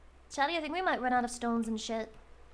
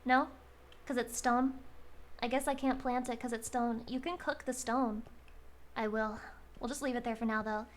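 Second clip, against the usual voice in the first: normal talking voice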